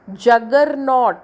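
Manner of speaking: Juggernaut slow, with नॉट stressed and falling. Shown as a demonstration. Teacher shows primary stress, नॉट drawn out in juggernaut. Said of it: "नॉट में आएगा primary stress".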